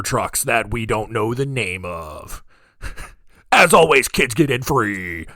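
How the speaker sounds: loud, intense hype announcer; monster truck announcer. Shown as a demonstration. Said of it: "that monster truck voice guy".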